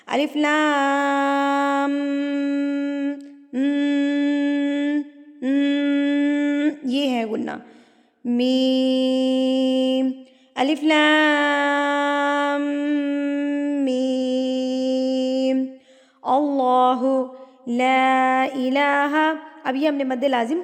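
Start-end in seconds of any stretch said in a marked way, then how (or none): 0.1-6.7 ام held long through the nose; nasal ghunna. Shown as a demonstration. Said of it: "ناک میں آواز کو لے کر جانا". 8.2-15.6 الف لام میم drawn out very slowly; slow recitation with ghunna; tajweed recitation. Shown as a demonstration. Teacher recites again. 16.2-19.3 slow, drawn-out chanted verse; recite, stretching each vowel; slow melodic recitation; Quranic recitation. Shown as a demonstration.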